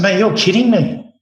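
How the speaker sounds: exasperated protest. Retold or imitated. Protesting to the sous chef mid-service, exasperated and rushed. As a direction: push back, you can't believe he means it.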